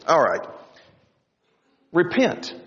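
normal talking voice

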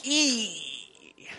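high, soft, drawn-out "ee"; cringing emoji noise. Acted. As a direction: squeal softly in awkward embarrassment. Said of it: "that emoji on your phone that's like".

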